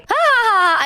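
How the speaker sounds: high, loud taunting ha-ha-ha; loud teasing fake laugh; mocking fake laugh. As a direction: cackle in mock amusement. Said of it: "her grin gets wider".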